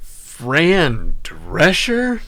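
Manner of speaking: stunned disbelief. Real, not acted. Stunned by game reference.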